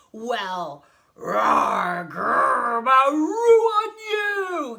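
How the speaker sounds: mocking roars building to a high yell; huge, drawn-out giant bellow. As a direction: bellow the animal noises back, climbing. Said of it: "he yelled".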